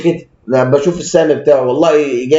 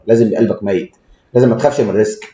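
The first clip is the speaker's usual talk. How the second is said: usual speaking voice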